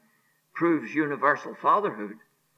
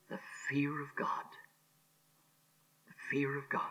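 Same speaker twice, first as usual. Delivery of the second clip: softer whispered repeat of fear; solemn whisper; 11 dB quieter than usual. Real, not acted. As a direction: hush it with awe.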